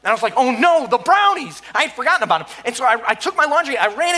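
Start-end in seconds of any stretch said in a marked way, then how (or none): 0.3-1.6 sudden dismay. Retold or imitated. Remembers the forgotten brownies.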